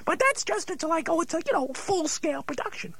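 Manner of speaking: normal talking voice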